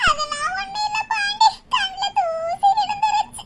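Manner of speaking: high, crying voice brushing off concern; tearful denial. Acted. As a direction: cover up the tears with a thin excuse. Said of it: "என்னம்மா அழுதுகிட்டு இருக்கீங்க".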